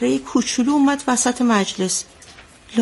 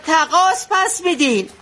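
retold shout; 6 semitones higher than usual.

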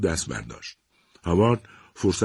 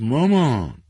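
pleading daughter; 6 semitones higher, 5 dB louder, much slower than usual. A narrator's reading. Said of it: "جین گفت".

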